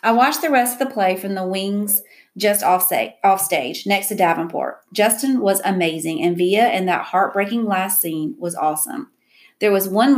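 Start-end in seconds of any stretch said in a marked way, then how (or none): none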